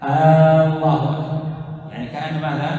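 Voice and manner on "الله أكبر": loud, slow "الله" with a long first "آ"; stretched opening hamza. Shown as a demonstration. Teacher showing students the mistake, opening hamza stretched. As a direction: hold the first vowel of "الله" like a question. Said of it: "بمد همزة".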